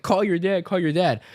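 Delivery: lightly voiced chanting food; nagging chickens.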